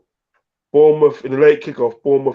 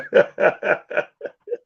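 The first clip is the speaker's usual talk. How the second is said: high laugh; 9 semitones higher, 3 dB quieter than usual. Real, not acted. Laughs at seven nil comment.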